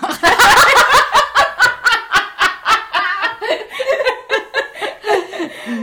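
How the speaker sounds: long, loud, high laughing fit; long laughter. Real, not acted. Laughing at the joke proposal.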